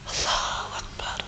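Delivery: barely whispered exclamation; hushed awe. Real, not acted. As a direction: breathe it out under your breath. Hushed reaction to sad story.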